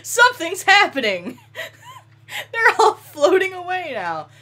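high laughing shout; laughing fit. Real, not acted. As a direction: crack up while talking. Laughing at bodies floating.

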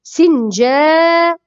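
سنجاب slowly, long jaa before the ending; slow model word. Shown as a demonstration. Teacher repeats the kasra example, slow and stretched. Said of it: "سين مكسورة في أول الكلمة".

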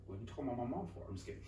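normal talking voice

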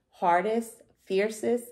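usual speaking voice

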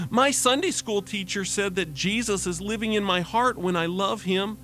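put-on high, earnest five-year-old voice; little girl voice. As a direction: share it gladly like a small child. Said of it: "said to her mom".

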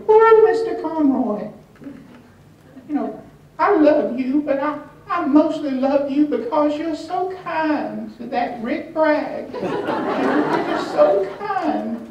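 high, loud gushing lady voice; sweet Southern lady. Retold or imitated. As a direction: gush sweetly in a badly faked lady's voice. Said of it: "I can't do a sweet lady Southern accent voice".

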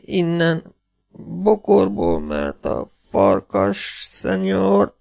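very high, slow, broken phrases; laboured gasping. A narrator's reading. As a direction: struggle out the words as an injured man. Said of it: "lihegte villogó szemmel".

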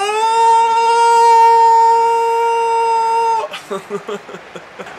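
long high-pitched excited laugh; giddy high laugh. Real, not acted. Gamer cracking up over Ronaldo, giddy laugh. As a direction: laugh out loud, carried away. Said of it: "I get a little excited".

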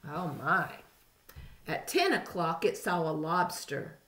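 ordinary delivery, nothing marked

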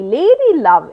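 ordinary delivery, nothing marked

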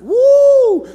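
high, loud whoop of joy; joyful woo. Pretend. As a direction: whoop like you just got a raise. Excitement over a raise.

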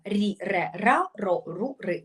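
рі, ре, ра, ро, ру, ри over-articulated, each one crisp; рі ре ра ро ру ри, дуже утровано; exaggerated syllables. Shown as a demonstration. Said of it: "Дуже утровано".

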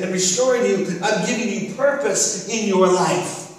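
impassioned preaching that climbs in pitch; insistent, rhythmic pulpit charge; building sermon climax. Acted. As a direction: drive each repeated promise harder, building to a peak.

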